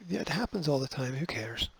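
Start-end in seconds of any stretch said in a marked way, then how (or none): none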